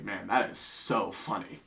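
low, slow and soft deadpan; mock him quietly, drawing it out; deadpan mockery. Mocks the rude customer to his face.

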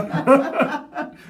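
rire haut et gêné; rire franc. Real, not acted. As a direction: laisse éclater un rire. Éclat de rire. (rire franc)

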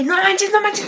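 no manches said high and breathless-excited; giddy schoolgirl gasp. Retold or imitated. Teen girl gushing over her crush, high excited squeal.

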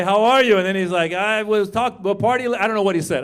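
ordinary talk

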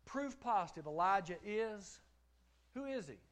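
full-force pulpit reading; fervent preaching. Acted. Pulpit build-up.

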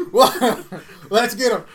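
loud, high rallying cry; rally the boys to riot; excited shout. Pretend. Mock riot call to arms.

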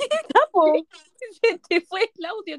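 high laughing voice; cracking up mid-line. Real, not acted. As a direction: crack up while talking. Friend's story breaks off.

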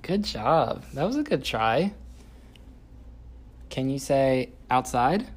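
loud, slow sing-song coaching. Real, not acted. Parent coaching toddler words.

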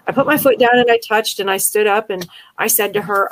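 normal talking voice

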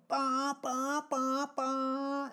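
high, loud put-on engine noise; engine revving. Imitating the van engine for the listener. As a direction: mimic the van roaring off.

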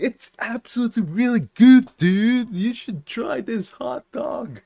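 high, loud mock sales pitch; overdone cheesy video character; hammed-up hot dog pitch. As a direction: gush like a badly acted customer.

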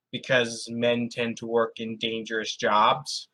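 usual speaking voice

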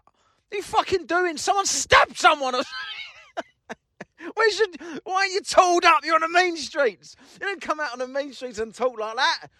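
egging them on in mock frustration; exasperated. Real, not acted. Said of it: "cringing of embarrassment".

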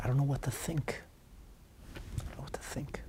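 hushed, fading to almost a whisper; helpless quiet quote. Retold or imitated. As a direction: voice the student's helplessness, very softly. Student's helpless written plea, acted.

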